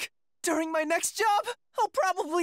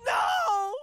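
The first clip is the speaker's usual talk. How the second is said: wailing no. Acted. Rejected, wailing.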